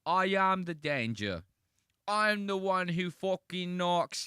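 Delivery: loud, high, slow British parody; mock British accent. Mocking a British take on the famous monologue, drawn-out British accent. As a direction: draw it out loudly in an exaggerated British accent. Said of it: "he's trying to do it in, like, a British accent".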